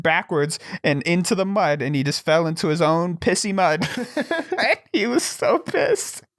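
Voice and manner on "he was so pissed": high, slow words squeezed through laughing; real laughter. Real, not acted. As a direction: crack up while telling it. Teller cracks up at his story.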